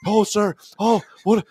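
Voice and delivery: high, rattled imitation of a scared receptionist; flustered Scottish receptionist. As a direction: play her panicking on the phone. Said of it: "she started getting so nervous and scared".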